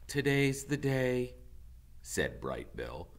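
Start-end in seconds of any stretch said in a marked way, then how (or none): 0.0-1.4 put-on a lightly higher young gosling voice; hint of a young goose. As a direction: answer like a calm young bird. Young goose Bright Bill answers.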